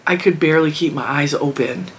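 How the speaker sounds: ordinary delivery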